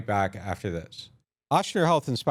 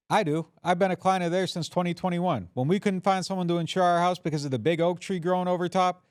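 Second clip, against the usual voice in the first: bright, higher radio ad voice; ad read; 5 semitones higher than usual. Acted. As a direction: sell it like a local radio spot. Host reads sponsor ads.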